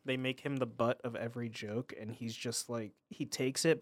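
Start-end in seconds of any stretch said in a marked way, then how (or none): none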